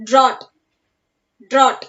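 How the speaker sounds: "drought" ending straight on T, GH silent. Shown as a demonstration. Teacher showing the class how to pronounce it, silent GH in "drought". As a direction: leave out the GH in "drought". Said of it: "So we have to pronounce this".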